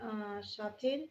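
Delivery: ordinary talk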